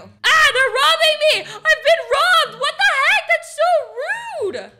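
high, loud mock wail; robbed mom wailing in outrage; outraged yell. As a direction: cry out like you've been robbed. Mom discovers she was robbed.

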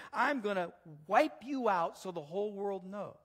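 loud, high, driving declamation; David's bold defiance. Acted. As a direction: proclaim it as a battle challenge. David defies Goliath in the reading.

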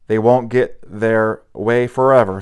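plain reading voice

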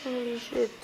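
quiet awed curse; hushed. Real, not acted. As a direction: murmur it under your breath. Creeping through horror game.

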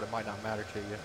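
normal talking voice

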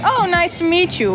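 friendly American. Retold or imitated. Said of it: "when you meet American, they're like".

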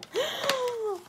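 quick high gasp of delight; sharp gasp. Real, not acted. Opening sister's gift, she gasps high with delight.

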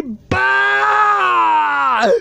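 long, loud drawn-out yell; shouted goodbye. Real, not acted. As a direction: yell it and hold the vowel. Gamer shouts at the game.

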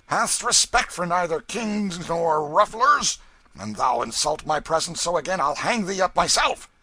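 loud, high, threatening; angry rebuke. A narrator's reading. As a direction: lay down the law at full voice. Ruffler rebukes Hobbs after knocking him down.

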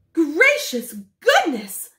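high, loud, slow astonishment; astonished exclamation. Acted. Wife stunned by the radio greeting, astonished. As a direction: gasp it out amazed. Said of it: "exclaimed Missus Popper".

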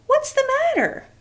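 high eager Elmo asking kindly; bright-pitched Elmo character voice. Acted. Elmo voice in story.

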